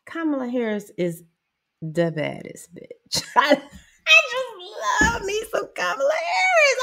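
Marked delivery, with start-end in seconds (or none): 3.4-6.8 high, laughing, close to tears; laughing gush. Real, not acted. Fan gushing about Kamala Harris, laughing. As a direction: gush with delight.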